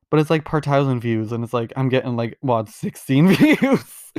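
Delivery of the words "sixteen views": laughing disbelief. Real, not acted. Mocking her own tiny view count.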